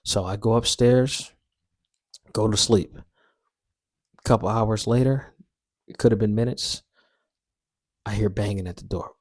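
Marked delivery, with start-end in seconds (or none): none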